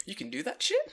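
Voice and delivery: high, incredulous squeak; a touch of amazed disbelief; mock disbelief. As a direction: gasp it in disbelief. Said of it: "I'm like".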